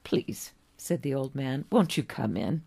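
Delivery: usual speaking voice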